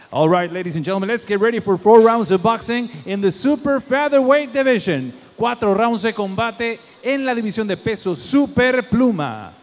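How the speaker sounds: ring announcer. Acted. Ring announcer starts the bout.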